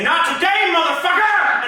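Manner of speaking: high, loud defiant shout. Shown as a demonstration. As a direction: yell it right in life's face.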